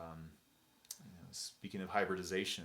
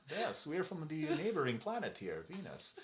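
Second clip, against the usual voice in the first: high-pitched mock German accent; 7 semitones higher than usual. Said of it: "they're talking in a German accent".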